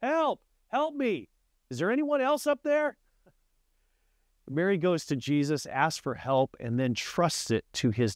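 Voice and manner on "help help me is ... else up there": high, frantic yell for someone else; comic desperate yell, pitched way up; yelling again. Retold or imitated. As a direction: call out much higher, all in a panic. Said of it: "Jim started yelling again".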